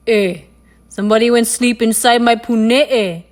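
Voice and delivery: loud, gruff papa animal voice; deep gruff voice. A narrator's reading. Papa Mongoose finds his bed slept in, loud and gruff. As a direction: boom it out gruffly. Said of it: "said Papa Mongoose in his deep, gruff voice".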